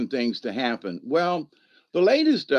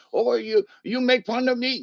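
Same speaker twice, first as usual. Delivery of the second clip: high, protesting put-on voice; mimicking family member; 10 semitones higher than usual. Family member objecting to being imitated, high mimicry. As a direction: imitate him objecting, pitched up. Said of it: "I'm trying to mimic you".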